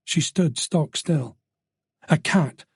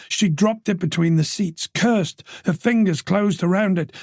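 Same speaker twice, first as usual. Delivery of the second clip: plain reading voice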